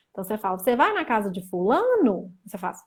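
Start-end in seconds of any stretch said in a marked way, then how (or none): 0.6-2.3 "fulano" lifted and curved at the end; question intonation. Shown as a demonstration. Teacher showing Portuguese questions, "fulano" with questioning tune. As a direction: swing the pitch up on "fulano". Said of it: "entonação de interrogação no final".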